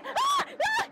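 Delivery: very high shrieks; startled screams. Real, not acted. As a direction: scream at a sudden scare. Jump scare in haunted maze.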